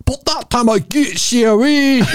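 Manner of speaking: put-on teacher snapping at a pupil, Scottish accent; angry Scottish teacher. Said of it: "The Scottish woman".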